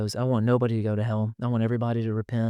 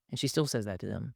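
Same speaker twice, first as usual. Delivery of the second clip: ordinary delivery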